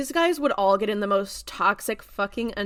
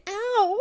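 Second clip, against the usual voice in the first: high-pitched mock cry of pain; comic "ow"; 12 semitones higher than usual. Teen stands up after the car hit him, comic ow. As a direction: play it as a silly little hurt.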